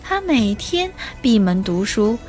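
ordinary delivery, nothing marked

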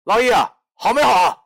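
焦急地催促; hurried urgent call. Acted. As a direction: rush him, the knives are coming. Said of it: "我忙得一星星说".

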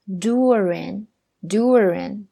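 durian with the DU hit hard and slow; stress on first syllable. Shown as a demonstration. Teacher shows where the stress in durian goes, slowly. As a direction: lean on DU in durian, durian, then let it fall. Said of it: "重音在前边".

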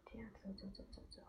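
soft rhythmic whisper. Acted. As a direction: whisper each step. Mother whispering to child.

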